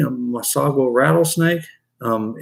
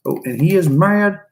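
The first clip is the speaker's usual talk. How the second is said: ordinary talk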